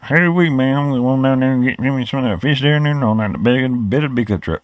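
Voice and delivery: playing up his own country twang for laughs; mock Southern country accent. Vlogger mocking how outsiders hear Southerners, in a lightly hammed-up country accent. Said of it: "I get that we sound like".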